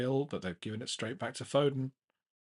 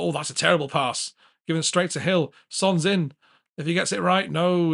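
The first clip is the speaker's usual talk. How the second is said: excited; 6 semitones higher, 9 dB louder than usual. Real, not acted. Big chance, bad pass live.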